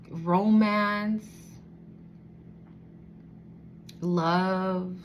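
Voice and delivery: slow, dreamy adoration, lingering on each word; lovestruck. Acted. This person secretly adoring the one they love, lovestruck. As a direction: savour each phrase with long pauses, as a secret. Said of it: "this person just looks like".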